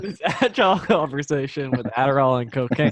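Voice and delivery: amused laugh through words; laughing at the dark turn. Real, not acted.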